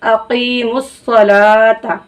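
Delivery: अकीमुस सलाता very slow, वाव silent, ला stretched; slow Quranic recitation. Shown as a demonstration. Teacher slowly recites अकीमुस सलाता for students. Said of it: "यहाँ भी हम वाव नहीं पढ़ेंगे".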